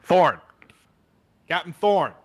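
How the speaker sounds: barks the captain's name, loud and high; sharp call. Acted. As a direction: call him to attention sharply. Inspector barking at captain.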